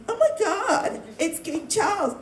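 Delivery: high-pitched fawning gush; mock awe. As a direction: gush in mock amazement, like a starstruck royal fan. Mocks fawning over royals.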